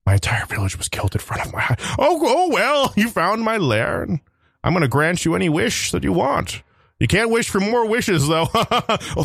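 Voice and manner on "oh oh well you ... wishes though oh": higher, slow grand dragon voice; a proud dragon announcing itself; magical dragon. Acted. Magical dragon greeting a wish-seeker. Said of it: "A magical dragon granting a wish".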